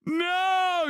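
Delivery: high, panicked shout. Real, not acted. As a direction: cry out in dismay. Reacting in horror to clip.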